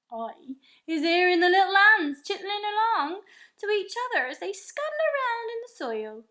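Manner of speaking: the giant boasting of his hearing with wonder; friendly giant voice. A narrator's reading. The BFG telling Sophie what he hears, in a giant's voice.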